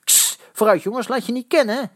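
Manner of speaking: sharp hissed ks, then high urging; provocative, high-pitched incitement; egging on. A narrator's reading. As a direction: goad the boys into a fight. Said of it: "hitsten de jongens op met een prikkelend".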